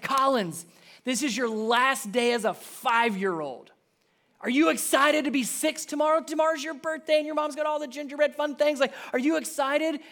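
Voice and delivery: high, eager excited question; excited dad. Retold or imitated. Dad asks his daughter about her birthday, high and eager. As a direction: pump up the birthday girl.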